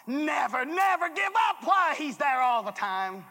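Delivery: high and loud, shouting the call; full-force preaching. Acted. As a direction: preach it to the back row. Exhortation peak.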